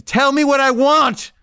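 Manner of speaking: high, drawn-out insistent cry; acted-out shrieked order, stretched slow; escalating demand.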